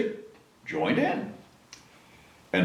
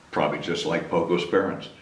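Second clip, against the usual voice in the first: ordinary delivery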